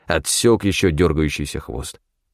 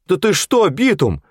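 high, startled crony voice; alarmed protest; 12 semitones higher than usual. A narrator's reading. Фёдор recoiling from the accusation, alarmed. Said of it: "шарахнулся назад Фёдор".

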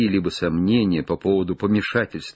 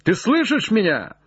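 high, raised calling question; shout; 11 semitones higher than usual. Acted. Legrand hails Jupiter in the tree, high shout. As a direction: call up to check you are heard. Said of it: "закричал он".

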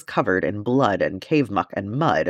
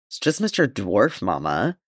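ordinary delivery, nothing marked

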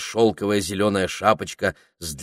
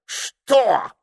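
very high, loud cry of outrage; an affronted parrot's outburst; indignant; 6 dB louder than usual. A narrator's reading. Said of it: "от возмущения даже привстал".

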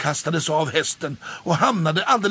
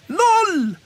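high, loud call from the crowd; 6 dB louder than usual. A narrator's reading. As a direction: shout the number to the stage. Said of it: "ropade Gullan".

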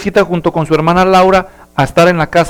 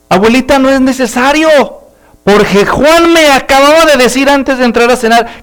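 put-on high, louder girl's voice; sly little girl; 7 semitones higher, 5 dB louder than usual. Laura tricking grandma into making Juan wash, high sly voice. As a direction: sweetly sell out her brother. Laura slyly volunteers her brother.

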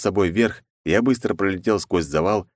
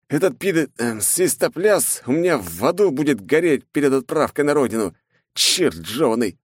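angry rant; 8 semitones higher than usual. Acted. Exhausted hero rants about his enemies. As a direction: spit out every hatred.

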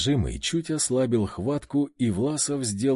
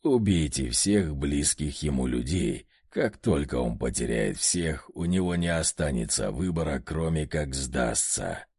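низкий медленный приказ демона; прикажи низко, холодно; зловещий демон; 9 semitones lower than usual. A narrator's reading. Одержимый приказывает убить близких. (низкий зловещий голос одержимого)

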